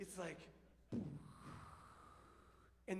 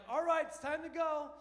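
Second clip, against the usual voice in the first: loud, higher cheery call to leave; jovial mom; 7 semitones higher, 8 dB louder than usual. Retold or imitated. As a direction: call everyone back cheerfully, full voice. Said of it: "it always starts kinda jovial".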